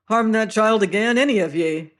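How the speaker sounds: loud angry warning; threat. Acted. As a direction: shout the threat. Hero threatens Sam.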